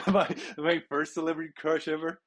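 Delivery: high breathy laugh over the words; embarrassed laugh. Real, not acted. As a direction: giggle sheepishly at the confession. Laughing about his crush.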